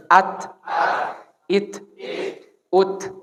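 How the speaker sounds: "At" cut off sharply, a little puff of air; Arabic ta. Shown as a demonstration. Teacher models the ta for students, clipped with a small puff. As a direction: stop the voice dead on the t. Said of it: "putus suara, angin keluar sedikit".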